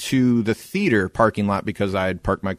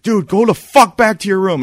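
higher, louder retold shout at the drunk; retold yell; 9 semitones higher, 6 dB louder than usual. Nick telling the drunk attacker to leave, loud retold yell. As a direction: bark him back to his room. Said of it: "And I'm yelling".

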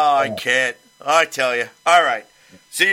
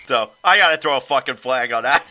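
ordinary delivery

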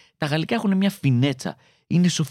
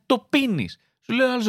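ordinary talk

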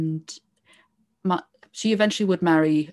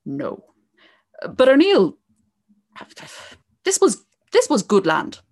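epic fantasy narrator; 2 semitones higher than usual. Acted. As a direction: build the legend like a fireside tale. Starts telling the O'Neill legend.

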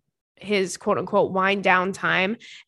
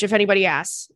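ordinary talk

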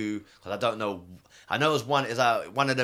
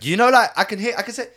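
loud, high, eager telling; bursting to share the gossip; excited; 9 semitones higher, 10 dB louder than usual. Real, not acted. To the hosts, loudly excited about what he overheard. Excited retelling of taxi story.

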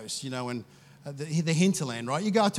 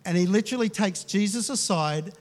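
ordinary talk, nothing marked

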